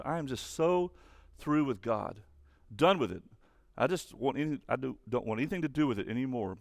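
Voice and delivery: ordinary talk, nothing marked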